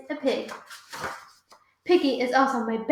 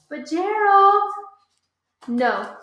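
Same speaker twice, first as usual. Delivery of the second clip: pleading; 7 semitones higher, 5 dB louder, slower than usual. Acted.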